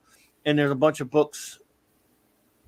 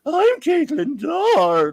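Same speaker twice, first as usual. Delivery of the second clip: high, loud, drawn-out mock introduction; hammed-up narrator attempting a woman's voice; George Takei; 11 semitones higher, 5 dB louder, much slower than usual. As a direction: belt out the name slowly in a silly high voice. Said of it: "it's George Takei reading the book".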